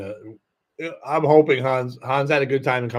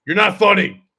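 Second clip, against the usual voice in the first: loud, raised mock-mad heckle; imitated angry outburst; 5 semitones higher, 8 dB louder than usual. Imitating the guy waiting next who gets too mad, angry shout. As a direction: burst out at him, louder and higher. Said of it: "he just gets too mad".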